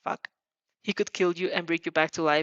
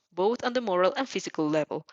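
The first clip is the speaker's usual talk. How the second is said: ordinary delivery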